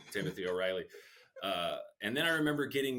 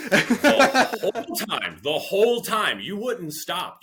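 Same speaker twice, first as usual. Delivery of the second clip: high, loud and slow exasperation; exasperated friend; 11 semitones higher, 13 dB louder, slower than usual. Retold or imitated. Dave telling his hungover friend off, loud and fed up. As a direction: lay it on him, fed up.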